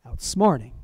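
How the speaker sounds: ordinary delivery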